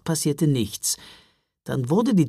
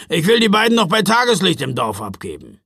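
louder, impatient grumble; grumbling; 4 semitones higher, 6 dB louder, faster than usual. A narrator's reading. Driver eager to drop off two children, grumbling loudly. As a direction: grumble it with gruff force. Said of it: "brummte er".

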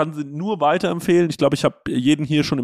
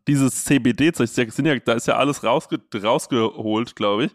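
ordinary talk, nothing marked

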